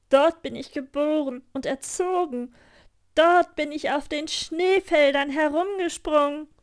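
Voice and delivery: hohe, laute, langsame Tierstimme; schwärme laut und langsam vom Schnee; schwärmendes Rentier. Acted. Rentier erzählt stolz. (Rentier schwärmt Gerda laut vom Norden vor)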